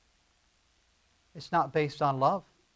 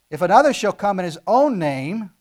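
loud proclamation; 4 semitones higher, 13 dB louder than usual. Acted. Forceful scripture quote.

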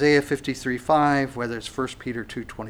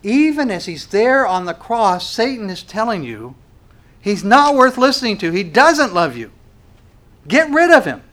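louder and higher, building to a cry; preaching at full force; 7 semitones higher, 7 dB louder than usual. Acted. Preacher exposing Satan's lies to the crowd, building and loud. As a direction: build it up until the last line rings out.

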